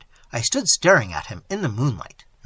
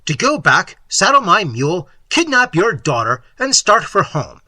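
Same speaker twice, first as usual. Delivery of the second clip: loud, punchy list of threats; fierce, emphatic vow; fierce resolve; 4 semitones higher, 7 dB louder than usual. A narrator's reading. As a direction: hammer out each step defiantly. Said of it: "said I fiercely".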